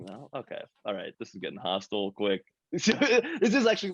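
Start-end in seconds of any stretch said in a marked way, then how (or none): 2.7-3.9 laughing. Real, not acted. Laughing mid-remark.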